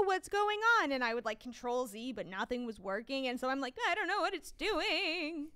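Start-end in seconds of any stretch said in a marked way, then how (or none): 0.0-0.9 high, loud bewildered cry; imitated helpless outburst at a glitch; mock panic. 3.9-5.5 high, slow, wavering whine; hammed-up despair, drawn out; mock helpless wail. Retold helplessness at glitch.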